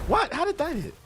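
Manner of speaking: high-pitched startled protest; real startled disbelief. Real, not acted. Gamer reacting to a hit, real disbelief. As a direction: blurt it out, pitch shooting up.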